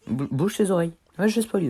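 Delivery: high, loud playful warning; warning shout. Real, not acted. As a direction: call out to the viewers. Avertit le public du spoil. (avertissement crié, aigu et joueur)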